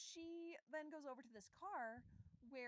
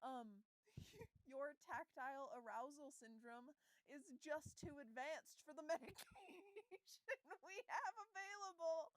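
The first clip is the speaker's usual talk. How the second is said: laughing through a quote; 3 semitones higher than usual. Real, not acted. Quoting doctor, cracking up.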